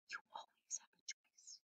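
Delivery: soft breathy whisper of an inner voice. Acted. Inner voice whispering to the child, hushed whisper. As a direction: breathe it like a secret from the heart. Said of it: "the one that whispers in my heart".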